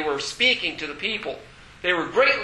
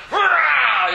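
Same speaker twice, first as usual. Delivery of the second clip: cheer; 8 semitones higher, 5 dB louder than usual. Acted. Cheering that Jesus is risen.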